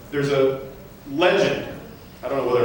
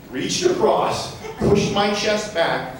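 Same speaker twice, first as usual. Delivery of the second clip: ordinary talk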